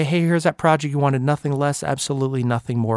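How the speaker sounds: low, soft, cowering mumble; timid employee. Pretend. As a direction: fade it down like a nervous worker. Imagined fearful employee.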